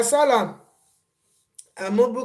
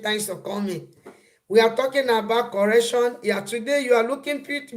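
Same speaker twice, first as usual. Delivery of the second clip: ordinary delivery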